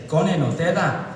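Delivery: slightly slowed mock Glaswegian. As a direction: spell it out in a Glasgow accent. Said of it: "they slow down what they're saying".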